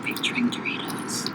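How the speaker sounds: soft playful whisper. Acted. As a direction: whisper the joke. Joking in ASMR whisper.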